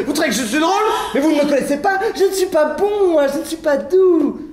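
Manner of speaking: high, emphatic comic bluster; furious protest. Acted. Man warns lover he is not gentle, high bluster. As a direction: insist hotly, pitch well up.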